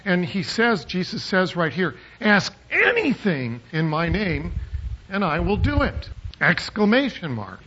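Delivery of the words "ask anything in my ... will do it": "anything" punched higher, the whole verse lifted; stress on anything. Shown as a demonstration. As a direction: overplay the word "anything" as if it had no limits. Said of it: "The problem here is the emphasis".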